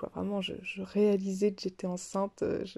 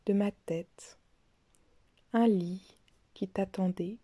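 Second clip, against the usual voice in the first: measured poetic reading, long pauses between lines; poem recitation. Acted. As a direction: recite it line by line, letting each phrase settle. Recites own poems after talk. (lecture de poème, posée, entrecoupée de silences)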